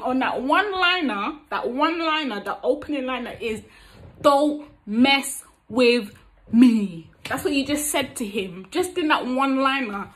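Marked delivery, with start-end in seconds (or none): 4.2-7.1 slow, loud, every word punched separately; spaced-out, emphatic warning; firm warning. Acted. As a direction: warn him off word by word. Acting out the one-liner warning.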